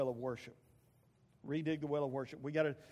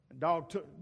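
ordinary delivery

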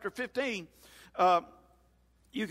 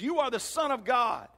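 higher-pitched, emphatic confession; bold declaration; 6 semitones higher than usual. Acted. Preacher as Peter addressing Jesus, bold declaration. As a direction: proclaim it with conviction. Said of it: "he looks and he says".

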